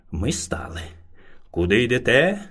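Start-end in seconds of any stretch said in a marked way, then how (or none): none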